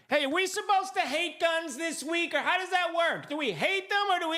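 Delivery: high, puzzled voice; mock confused crowd. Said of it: "the crowd was like".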